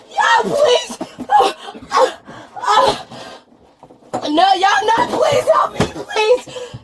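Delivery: high, breaking cries between gasps; real breathless distress; panicked pleading. Real, not acted. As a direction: beg in broken bursts, gasping. Begging for help, unable to breathe.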